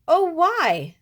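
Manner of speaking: very loud high wail; a little boy protesting the horse leaving; prince's cry. Acted. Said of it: "the prince cried".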